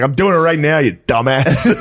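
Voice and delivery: imitating dad snapping at mom; dad snapping back. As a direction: fire it back annoyed.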